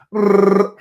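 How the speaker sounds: loud, high trilled tongue R; rolled R. Shown as a demonstration. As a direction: hold a long, voiced tongue trill. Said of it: "if you can roll your R's".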